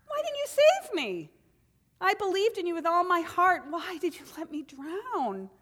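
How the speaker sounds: pleading drowned man. Acted. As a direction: cry out bewildered at God. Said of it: "he asked God".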